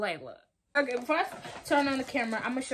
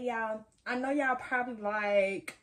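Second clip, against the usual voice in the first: ordinary delivery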